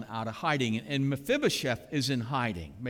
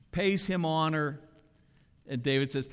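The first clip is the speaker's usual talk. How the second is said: ordinary talk, nothing marked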